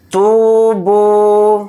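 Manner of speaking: تو and بو each held one alif length; slow even تو بو; both syllables stretched. Shown as a demonstration. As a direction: draw out تو and بو equally. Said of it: "تا کو بھی کھینچنا ہے، با کو بھی".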